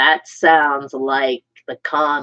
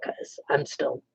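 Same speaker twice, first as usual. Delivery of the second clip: ordinary delivery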